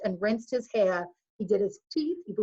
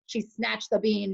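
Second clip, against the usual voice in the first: plain reading voice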